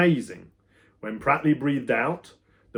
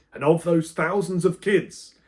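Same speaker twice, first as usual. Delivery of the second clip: loud, higher scolding; angry teacher; 6 semitones higher, 5 dB louder than usual. A narrator's reading. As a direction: keep the fury going. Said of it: "Pratley continued".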